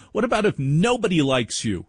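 ordinary talk

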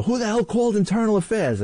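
a hint of a captain shouting from his car window; mild mock yell. Retold captain yelling out the window at rookie. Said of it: "starts yelling out the window".

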